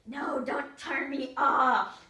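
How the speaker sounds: put-on slow, loud, drawn-out child's plea; loud, stretched-out begging shout; loud slow wail. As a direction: wail it out as he grabs the remote. Grabs remote, begs again louder.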